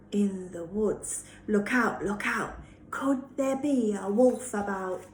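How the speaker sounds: plain reading voice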